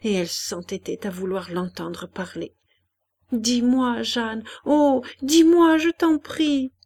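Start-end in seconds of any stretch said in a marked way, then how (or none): 3.3-6.7 anguished plea rising high; pleading. A narrator's reading. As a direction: beg her, building to a loud, high appeal. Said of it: "en la suppliant de répondre". (supplication angoissée)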